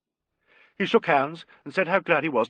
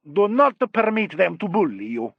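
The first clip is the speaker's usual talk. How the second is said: put-on higher Italian-accented character voice; marked Italian accent; 6 semitones higher, 4 dB louder than usual. A narrator's reading. Italian maestro advising a painter, marked Italian accent. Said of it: "spoke with a marked Italian accent".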